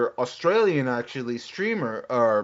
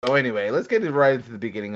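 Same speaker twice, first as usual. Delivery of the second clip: normal talking voice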